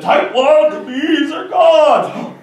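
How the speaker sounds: high, loud, slow yell; furious stretched-out yell; angry yell. Acted. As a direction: shout at him in fury. Angry character yells.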